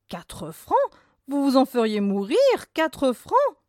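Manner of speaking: scandalized mistress, pitched high; indignant outcry. A narrator's reading. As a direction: shriek the price back at him in disbelief. Indignant mistress repeats the price.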